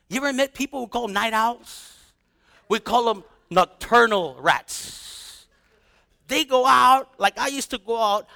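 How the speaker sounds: ordinary talk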